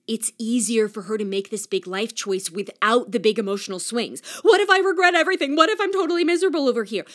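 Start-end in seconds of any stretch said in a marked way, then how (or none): 4.4-7.0 higher, louder, fast panicky worrying; anxious friend. Retold or imitated. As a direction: rattle off the fears in a rush. Voicing friend's catastrophizing.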